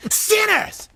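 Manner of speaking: high, far-off shouted sinners; mock Whoopi. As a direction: proclaim it like a flashcard reveal. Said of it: "you needed a flashcard for the word".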